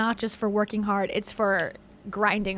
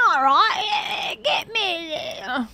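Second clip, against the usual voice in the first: hammed-up, higher-pitched Australian accent; 6 semitones higher, slower than usual. Shown as a demonstration. Host shows off his Australian accent, exaggerated. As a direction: put on a broad Australian accent. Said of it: "That's my Australian accent".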